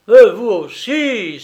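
loud, high, drawn-out outburst; bizarre exclamation. A narrator's reading. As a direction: exclaim loudly in a strange tone. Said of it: "s'exclama-t-il d'un ton bizarre". (exclamation forte, d'un ton bizarre)

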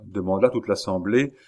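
usual speaking voice

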